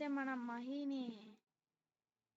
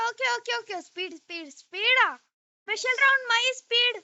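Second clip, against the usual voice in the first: excited shouting; 7 semitones higher, 14 dB louder than usual. Real, not acted. Racing game speed boost.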